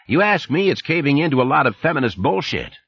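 a chummy Texan supervisor on the phone; Texas twang. Acted. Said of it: "the familiar voice with the Texas twang".

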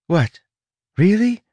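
high, startled disbelief; surprised. Acted. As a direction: gasp in disbelief. Tress is astonished.

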